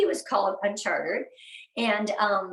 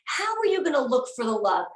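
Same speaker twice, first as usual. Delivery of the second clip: usual speaking voice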